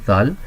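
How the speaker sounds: ذال said with the tongue between the teeth; demo of dhal. Shown as a demonstration. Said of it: "زبان تھوڑی سی دو دانتوں ... دانتوں سے باہر نکلے گی".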